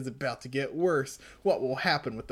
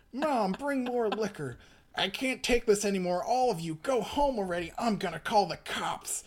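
upset father voice; 7 semitones higher than usual. Acted.

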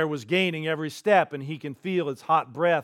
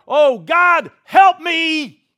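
much higher and louder, a panicked yell for help; desperate cry; 13 semitones higher, 14 dB louder, much slower than usual. Acted. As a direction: scream it as the bear strikes. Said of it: "When the guy cries out".

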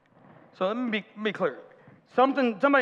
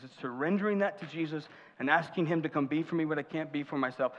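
ordinary delivery

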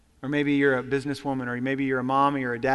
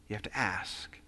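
you have to ask whispered, lower and softer; whispered emphasis; 8 semitones lower, 13 dB quieter than usual. Shown as a demonstration. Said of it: "repeated for emphasis".

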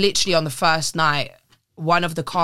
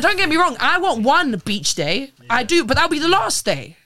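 high mocking voice; mock high-pitched whine; 9 semitones higher, 4 dB louder than usual. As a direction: put on a squeaky imitating voice. Mocking friends' beach plan.

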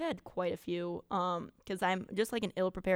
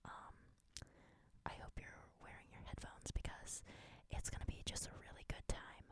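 hushed, breath-only welcome; ASMR whisper; 8 semitones higher, 11 dB quieter than usual. Pretend. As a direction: pretend ASMR host, fully whispered. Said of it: "We should do an A S M R episode".

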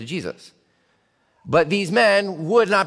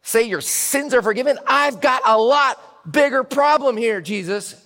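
louder and higher, insistent protest; exasperated complaint; 7 semitones higher, 4 dB louder than usual. Acted. Man on the mat to Jesus, loud exasperated protest. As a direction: vent your frustration at Jesus. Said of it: "if I were the guy in the mat".

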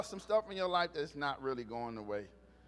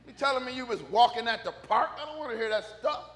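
raised, louder mock complaint; exasperated rant; 5 semitones higher, 7 dB louder than usual. Son complaining about hearing of walks at the park, mock-indignant. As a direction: protest in comic indignation. Continues acted rant at his mom.

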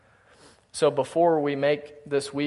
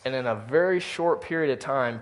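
ordinary talk

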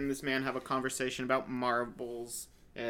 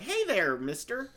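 little Ron Howard; 7 semitones higher than usual. Retold or imitated. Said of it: "little Ron Howard is like".

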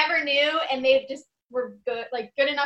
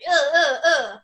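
repeated grunts of revulsion, louder; mock retching disgust; disgusted "ugh"; 3 semitones higher, 6 dB louder, slower than usual. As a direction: recoil in irritation with each "ugh". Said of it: "just irks me".

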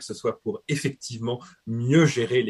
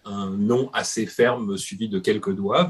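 usual speaking voice

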